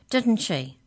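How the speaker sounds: insistent, accusing query; demanding question. A narrator's reading. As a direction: demand agreement sharply. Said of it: "he demanded".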